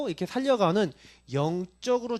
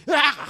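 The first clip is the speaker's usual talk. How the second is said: short, higher burst of road rage; mock angry yell; 8 semitones higher, 4 dB louder than usual. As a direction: imitate an exasperated driver's outburst. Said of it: "화가 날 때가 있어요".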